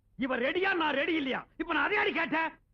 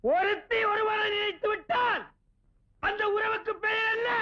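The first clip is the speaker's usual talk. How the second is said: exasperated shout; 5 semitones higher than usual. Acted. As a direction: shout it to someone hard of hearing. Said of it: "நான் கத்துறது கும்பகோணம் கோபுரமே". (உச்சக் குரலில் இழுத்துக் கத்துவது)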